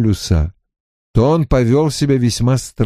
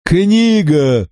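cryptic exclamation; 6 semitones higher than usual. Acted. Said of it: "произнёс он".

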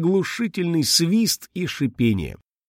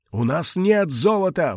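put-on high desperate old man's plea; desperate cry; 6 semitones higher than usual. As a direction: cry out in despair. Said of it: "в отчаянии закричал старик".

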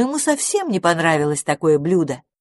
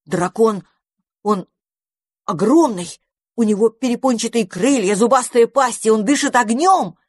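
rising, louder excited rush; blurting out; 4 semitones higher, 3 dB louder than usual. A narrator's reading. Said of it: "выпалил".

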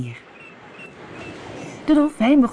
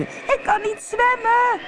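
drawn-out, very high mermaid cry; panicked high-pitched scream; slower than usual. A narrator's reading. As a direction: wail it slowly at the top of the voice. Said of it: "schreeuwde ze".

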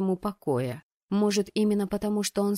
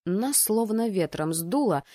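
usual speaking voice

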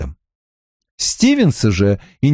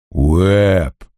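a groaning cry of the name; moaning; 4 semitones lower than usual. A narrator's reading. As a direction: moan it in despair. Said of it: "простонал Сперлинг".

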